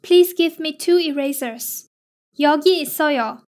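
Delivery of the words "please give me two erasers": ordinary talk, nothing marked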